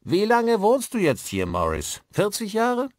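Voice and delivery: loud, high-pitched asking; addressing old man loudly. A narrator's reading. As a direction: call the question over to the old man. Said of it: "Dann wandte er sich diesem zu".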